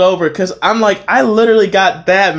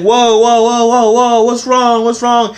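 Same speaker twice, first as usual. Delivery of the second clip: higher-pitched string of startled whoas; mock alarm; 6 semitones higher, 3 dB louder, slower than usual. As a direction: imitate coworkers trying to stop an outburst. Acting out coworkers calming him.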